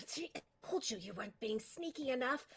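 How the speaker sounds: a quiet, lightly voiced scold; a lightly voiced lurker scolding his partner; hissed scolding voice. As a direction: tell off a partner under your breath. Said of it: "you hear a voice kind of go".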